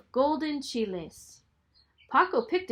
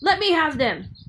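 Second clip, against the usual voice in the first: giant demanding; 4 semitones higher, 7 dB louder than usual. Acted.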